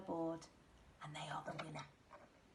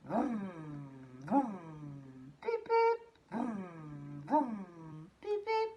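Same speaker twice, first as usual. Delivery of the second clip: car noise imitation; 5 semitones lower, slower than usual. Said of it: "wonder what makes that sound".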